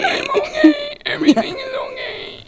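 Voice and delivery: very high, laughing inhaled squeak; squeaky E.T. kid voice. Shown as a demonstration. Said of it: "That's the one".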